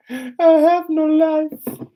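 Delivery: high, loud, slow mock wail. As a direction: wail it out like a melodrama. Peak of mock lament.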